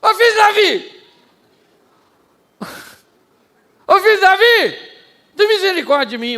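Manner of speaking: high, loud desperate shouting; desperate cry. Retold or imitated. Blind Bartimeu calling to Jesus, desperate shouted cry. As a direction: cry out with all you have.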